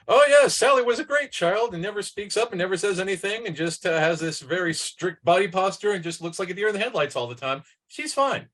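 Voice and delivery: high-pitched, mock upbeat teacher; breezy teacher. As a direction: gush like a teacher who sees no problem. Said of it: "teachers inevitably say".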